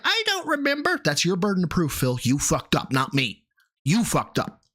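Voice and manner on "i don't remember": very high whiny put-on voice; high mocking shrug of a line; mock evasive answer. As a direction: play the dodger, squeaky and innocent.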